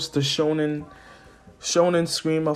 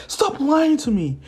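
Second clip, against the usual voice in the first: pleading mother; 12 semitones higher, 4 dB louder than usual. Retold or imitated. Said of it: "And Inko be like".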